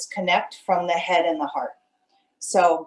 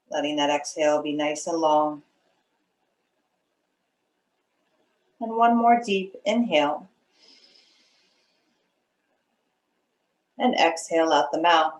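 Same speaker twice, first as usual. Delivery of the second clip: unhurried meditation guide with long pauses; guided meditation; 2 semitones lower than usual. Acted. Meditation leader settling students into their breath, calm guiding voice. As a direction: ease them into stillness, phrase by phrase.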